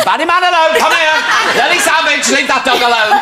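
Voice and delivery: high-pitched comic holler at dogs; mock-shout across a park, laughing; imagined shouting. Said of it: "then she's shouting".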